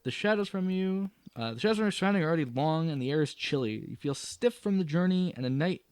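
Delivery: projected storytelling voice; story narration. Acted. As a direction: read it out like a game master setting the scene. Reads the adventure text aloud.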